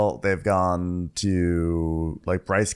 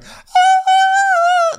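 very high, loud sustained choir note; held soprano wail, imitating a choir; imitated choir; 9 dB louder than usual. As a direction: belt a piercing mock-operatic "ah". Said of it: "The choir's going".

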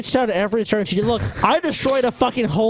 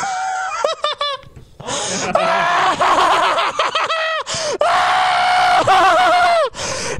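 maniacal laugh. Real, not acted. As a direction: cackle like a madman. Drunk boast after a spill.

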